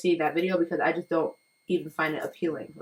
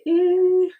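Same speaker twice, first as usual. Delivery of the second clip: disgusted eh; 13 semitones higher, 10 dB louder than usual. Retold or imitated. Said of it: "I was just like".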